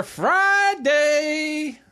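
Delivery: long high Friday; drawn-out sign-off. Real, not acted. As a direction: stretch out the day name. Show sign-off, voice rising.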